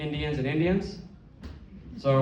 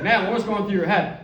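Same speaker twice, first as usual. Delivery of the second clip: louder, higher sports-reporter question; post-fight interviewer; 5 semitones higher, 5 dB louder than usual. Retold or imitated. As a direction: ask it like a ringside reporter.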